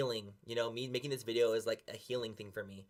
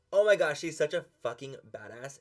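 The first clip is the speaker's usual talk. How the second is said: higher and louder, thrilled fangirl outburst; excited gush; 5 semitones higher, 10 dB louder than usual. Retold or imitated. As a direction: gush like you just found your new idol. Recalls her reaction to the girl.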